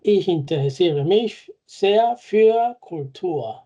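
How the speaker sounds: slow, careful German example; deliberate teacher pace; slow model sentence. Shown as a demonstration. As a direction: read it slowly for learners. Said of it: "La preposición es für".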